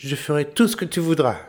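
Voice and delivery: high eager voice; sly little tailor. A narrator's reading. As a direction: pitch it up, eager. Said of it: "dit le tailleur".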